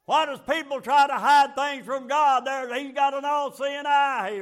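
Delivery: loud and high, rising in fervor; fervent preacher's cry; preaching shout. Real, not acted. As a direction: drive it home to the congregation.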